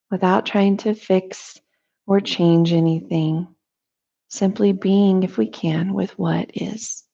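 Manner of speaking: calm, measured meditation voice with long pauses; guided meditation. Real, not acted. Teacher leading listeners through a morning breathing meditation, calm guided delivery. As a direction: guide them gently into noticing breath and body, unhurried.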